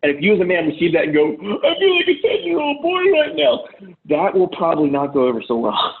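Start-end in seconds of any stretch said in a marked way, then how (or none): 1.3-3.6 high, slow, mock-crying whimper; a grown man hamming up a tearful little boy; whiny crying boy. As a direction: whine it like a hurt kid about to cry. Mocking a whiny man to wife.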